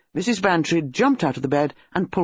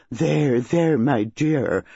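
soothing with relish; much slower than usual. A narrator's reading. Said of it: "with some relish".

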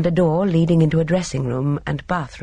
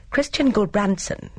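a touch of relish, higher in pitch; grim relish; 6 semitones higher than usual. A narrator's reading. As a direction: announce it with understated grim relish. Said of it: "gave it with a kind of grim relish".

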